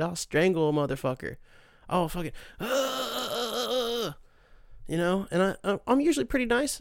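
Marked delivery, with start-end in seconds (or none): 2.6-4.2 long drawn-out frustrated "ah"; higher, drawn-out cry of exasperation; exasperated groan. Acted. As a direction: let out a mock strangled cry. Fed up with movie talkers.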